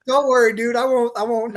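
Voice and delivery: excited retold promise; laughing eager promise. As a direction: blurt the promise, still laughing. Eager promise to boss after laugh.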